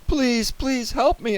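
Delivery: high-pitched begging; desperate plea. A narrator's reading. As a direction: plead for help. Boy begs Mr. Carp.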